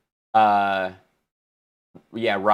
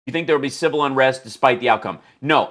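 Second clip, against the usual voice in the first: ordinary talk, nothing marked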